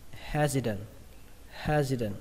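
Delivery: "Hesitant" with the middle t softened to d. Shown as a demonstration. Teacher shows another way to read it, T as D. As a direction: say "Hesitant" as hezi-den. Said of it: "đọc âm T này thành âm D".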